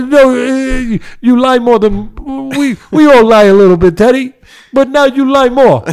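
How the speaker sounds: loud, high, slow mock Spanish accent scolding; a hammed-up Spanish accent calling him a liar. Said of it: "putting on that accent".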